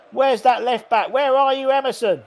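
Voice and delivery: high, loud, exasperated call; frustrated shout. Real, not acted. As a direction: yell for the missing defender. Yelling for the missing left back.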